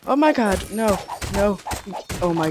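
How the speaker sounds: high, loud frightened outburst; panicked yell. Real, not acted. Gamer yelling at a sudden monster, panicked and loud. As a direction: shriek it in real fright.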